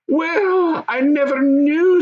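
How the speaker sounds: lightly voiced goblin, high and wondering; a hint of a wide-eyed young goblin; another goblin voice. Fourth goblin marvelling at the talk, lightly voiced. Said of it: "remarked a fourth voice".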